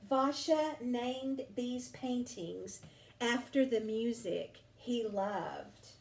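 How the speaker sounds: plain reading voice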